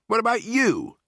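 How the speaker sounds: aggrieved squeal; indignant retort. A narrator's reading. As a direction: throw the question back, affronted. Bruno turns it on narrator.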